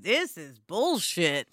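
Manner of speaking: fed-up grumble. Retold or imitated. Host imagining the show's creator fed up, slow low grumble. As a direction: mutter it like a worn-out writer on a bad day. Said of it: "he had some days where he was like".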